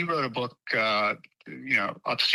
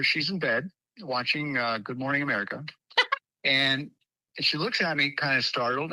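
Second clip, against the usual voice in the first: normal talking voice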